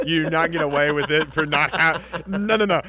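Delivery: loud laughing callout; mock outrage, loud; playful scolding. Real, not acted. Playful scolding over clap.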